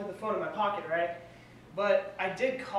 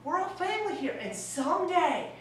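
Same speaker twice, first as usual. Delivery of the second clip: much higher fatherly coo; high-pitched dad; 10 semitones higher, 5 dB louder than usual. Retold or imitated. Dad soothing son on the phone, high mock coo. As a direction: reassure the nervous son in a squeaky voice. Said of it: "speaking high-pitched and everything".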